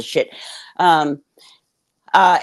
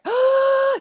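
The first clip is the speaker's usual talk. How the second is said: gasp. Retold or imitated. Threatened with police.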